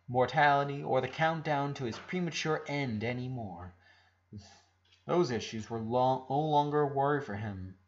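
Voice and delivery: usual speaking voice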